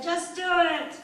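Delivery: loud, high command; flustered, emphatic demand; panicked order. Acted. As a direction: urge him loudly to get on with it. Acted panicked order to lover.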